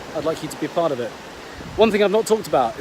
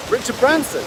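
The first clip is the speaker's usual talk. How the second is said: retold disbelief, pitched high; surprised echo; 7 semitones higher than usual. Student reacting to instructor's famous boss, retold surprise. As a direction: echo the name as if astonished. He echoes the name, amazed.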